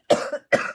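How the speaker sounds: real chesty cough; lingering cough from a cold. Real, not acted. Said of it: "my cough is still lingering".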